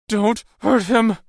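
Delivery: high, loud, slow plea; forceful, desperate cry; shouted. A narrator's reading. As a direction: shout it to protect him. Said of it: "Maisie shouted".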